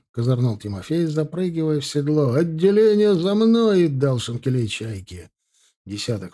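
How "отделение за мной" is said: high, loud slow rallying call; rally the squad behind you; command shout. Acted. Leading squad into pursuit.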